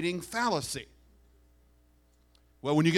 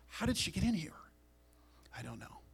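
scandalised whisper; 5 semitones higher, 8 dB quieter than usual. Retold or imitated. Pharisees whispering about the woman who walked in. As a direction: hiss it under your breath in shock.

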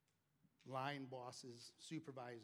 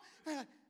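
real laugh at the story; 11 semitones higher than usual. Real, not acted. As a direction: burst of laughter. Laughing at the memory.

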